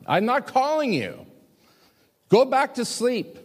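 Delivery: high, exasperated Eli, slowing on "go back to sleep"; a grumpy Eli, woken up yet again; annoyed old Eli. Acted.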